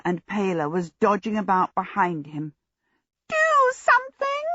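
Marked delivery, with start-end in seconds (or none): 3.3-4.5 very high, slow trumpeting shriek; shrill little djinn shout, words drawn out; small djinn shouting. A narrator's reading. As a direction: pipe it out like a silver trumpet. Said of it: "the small djinn shouted".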